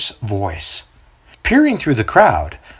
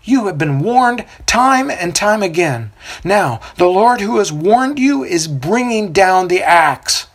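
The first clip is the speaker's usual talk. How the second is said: accusing; 7 semitones higher, slower than usual. A narrator's reading. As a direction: rebuke them as vipers. Prophet warns crowd.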